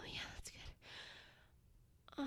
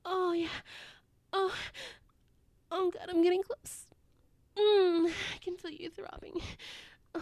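moans growing louder and higher to a peak; building ecstasy; 4 semitones higher, 17 dB louder than usual. Acted. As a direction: let breathy pleading build into loud cries. Acted scene building to climax.

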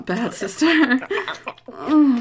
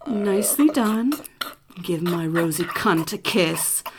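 usual speaking voice

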